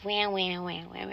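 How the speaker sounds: mock baby wah-wah crying; lightly mimicking sobbing emojis. Mocking his crying-emoji texts with a fake wah-wah. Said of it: "writing me crying emojis".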